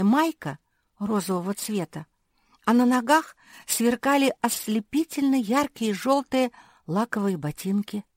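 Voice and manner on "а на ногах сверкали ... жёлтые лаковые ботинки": ordinary delivery, nothing marked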